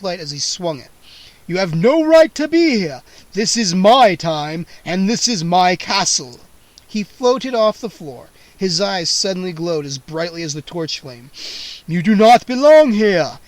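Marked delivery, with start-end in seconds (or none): 1.5-6.4 angry vampire count. A narrator's reading. Vampire voiced in story. 11.9-13.4 put-on very loud menacing old vampire. A narrator's reading. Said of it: "he repeated menacingly".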